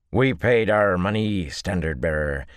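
lightly twangy soldier voice; twangy accent. Acted. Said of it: "in the same twangy accents".

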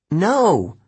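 high, insistent denial. A narrator's reading. Annabeth refuses to believe Luke is gone, insistent. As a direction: refuse to accept it, pitch leaping up. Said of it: "she insisted".

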